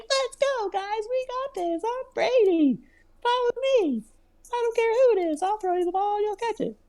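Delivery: squeaky hammed-up imitation of a cocky quarterback; mock Tom Brady pep talk. Brady rallying his teammates in a squeaky mock voice. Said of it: "he's gonna be like".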